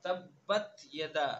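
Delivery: very slow, drawn-out chanted recitation; tajweed chant; Quranic recitation. Acted. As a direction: recite solemnly, stretching every vowel. Recites the surah.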